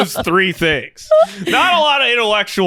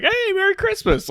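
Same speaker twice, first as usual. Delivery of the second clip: mock cheery greeting; 3 dB quieter than usual. Imagined awkward neighbor greeting.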